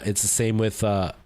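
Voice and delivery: normal talking voice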